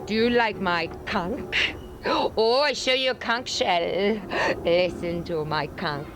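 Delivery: slow, lazy island accent; flirty Bahamian drawl, slow; Caribbean accent. Shown as a demonstration. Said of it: "How about the Caribbean?".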